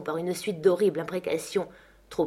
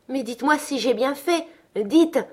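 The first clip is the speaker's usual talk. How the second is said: higher-pitched, impatient demand; irritated exclamation; 7 semitones higher, 5 dB louder than usual. A narrator's reading. Young woman pressing her nurse for approval, irritated. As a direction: press for an answer, irritated, ending louder. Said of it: "s'écria-t-elle avec irritation".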